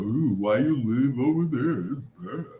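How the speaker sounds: hammed-up worried neighbor, trailing off softly; mock fretting busybody. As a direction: imitate someone fussing over where you live. Mimicking critics of everywhere he lived.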